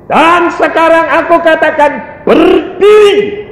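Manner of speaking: high, loud divine command, last word drawn out; perintah menggelegar. Retold or imitated. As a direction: thunder the order, stretching the final word. Acts God's rising command. (seruan lantang memerintah, ujungnya dipanjangkan)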